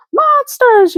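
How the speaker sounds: a very high frightened yell. Acted. Lizzie yelling in fright at the eyes in the dark house, a high yell. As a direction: scream it in a panic. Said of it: "yelled Lizzie".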